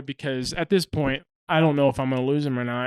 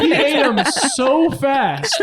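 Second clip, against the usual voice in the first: laughing; 8 semitones higher, 4 dB louder, slower than usual. Real, not acted. Laughing retelling.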